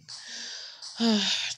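long breathy sigh; drawn-out role-play sigh; sad sigh. Pretend. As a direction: heave a pretend-sad sigh.

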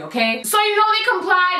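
ordinary talk

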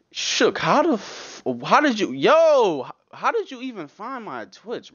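drawn-out disbelieving high voice; surprised. Real, not acted. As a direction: stretch it out in shock. Reacting to chat stalker.

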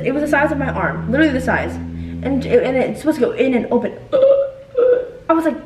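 ordinary delivery

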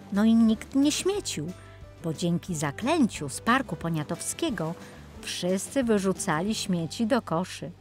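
usual speaking voice

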